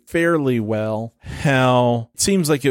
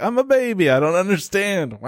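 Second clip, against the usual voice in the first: high whiny baby voice with a fake wah; 7 semitones higher than usual. The baby answers its mom, high mock baby whine. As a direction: play a clueless baby, high and silly. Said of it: "the baby's like".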